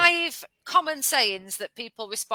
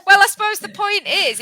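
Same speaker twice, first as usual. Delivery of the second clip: laughing; 11 semitones higher, 10 dB louder than usual. Real, not acted. Reacts to laughter.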